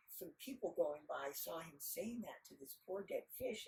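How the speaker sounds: ordinary delivery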